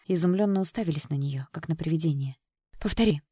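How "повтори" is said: ordinary delivery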